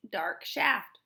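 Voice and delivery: ordinary delivery, nothing marked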